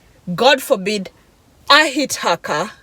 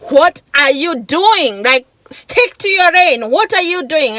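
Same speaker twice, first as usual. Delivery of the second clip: higher, emphatic retold shout; 6 semitones higher, 5 dB louder than usual. Driver shouting at the man through her window, retold shout. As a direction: imitate shouting out of a car window, pitched up. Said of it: "shouting at him".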